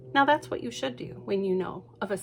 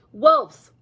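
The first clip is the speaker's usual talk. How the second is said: loud, sharp single word; insistent; 5 semitones higher, 11 dB louder than usual. Acted. Lucy insisting to her mother. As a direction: blurt it out firmly.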